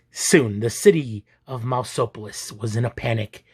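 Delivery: plain reading voice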